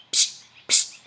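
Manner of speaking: two short hissed psst sprays; perfume spray sound. Acted. Narrator sprays perfume on the letter, imitated spray hiss. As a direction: imitate an atomizer with two sharp pssts.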